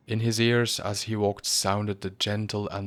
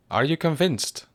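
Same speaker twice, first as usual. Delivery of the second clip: higher and louder, impatient; rather irritably; 8 semitones higher, 6 dB louder than usual. A narrator's reading. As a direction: push him, irritated. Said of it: "said Gildea rather irritably".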